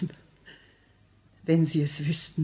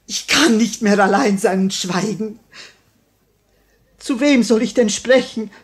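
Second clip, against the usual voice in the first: anguished outburst; 3 semitones higher, 8 dB louder than usual. Acted. Widow crying out to her husband's murderer. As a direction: let the grief burst out loud.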